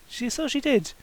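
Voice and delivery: ordinary delivery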